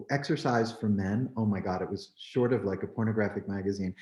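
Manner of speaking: ordinary talk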